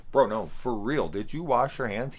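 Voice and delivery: incredulous loud repeat of "did you wash your hands"; insistent loud. Retold or imitated. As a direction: press him hard, for real now. Insisting on hygiene question.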